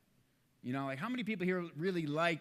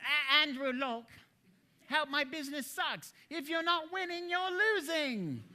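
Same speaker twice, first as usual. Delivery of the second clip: queen voice for Andrew; 10 semitones higher than usual. Retold or imitated. Speaker plays a colleague as a queen in a high grand voice. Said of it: "I have to think of, like, some queen".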